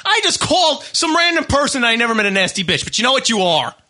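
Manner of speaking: high, heated outburst at a listener; angry rant. Real, not acted.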